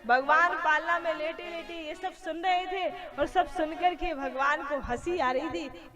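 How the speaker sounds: usual speaking voice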